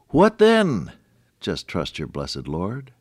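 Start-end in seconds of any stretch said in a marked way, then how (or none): 0.1-0.9 urgent question. Real, not acted. Rhetorical question in sermon.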